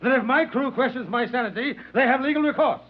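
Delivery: furious captain threatening a passenger; captain shouting. Acted. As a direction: roar at him that you are master of this ship. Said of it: "I could hear the captain shouting".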